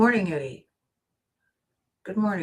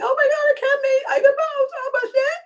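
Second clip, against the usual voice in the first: shrieking far higher with joy, near tears; ecstatic screaming; 5 dB louder, faster than usual. Retold or imitated. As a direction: scream like you just hit the jackpot. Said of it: "screaming and yelling".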